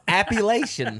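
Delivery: "Appalachian" said as app-uh-LAY-shun; "Appalachian" with a long A, mockingly; mocked mispronunciation. Shown as a demonstration. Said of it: "can't say it correctly".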